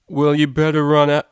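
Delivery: loud caller voice. Acted. Prank caller flubbing the line.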